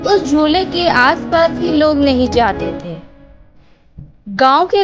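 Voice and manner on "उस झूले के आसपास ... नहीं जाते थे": usual speaking voice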